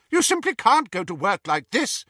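high, loud urgent plea; crying out. Acted. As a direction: cry it out in alarm. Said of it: "cried Hermione".

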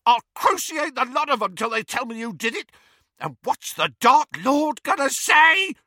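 very high, raging threat; frantic shriek. A narrator's reading. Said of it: "he shrieked".

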